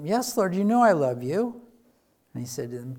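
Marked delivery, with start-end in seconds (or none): 0.0-1.6 higher and louder, pleading to be believed; louder, higher plea of love; earnest insisting. Acted. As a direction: Peter pressing his answer, voice rising. Peter's second, pressed answer.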